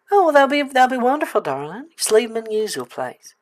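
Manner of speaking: lightly put-on accent, not the Southern one; wrong accent slipping in. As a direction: slip back into the old character's accent. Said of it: "getting stuck in the other accent".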